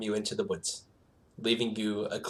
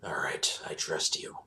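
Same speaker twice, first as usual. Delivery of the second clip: soft low whisper; 7 semitones lower, 6 dB quieter than usual. Acted. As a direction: breathe it gently. Owl bear whispers trust.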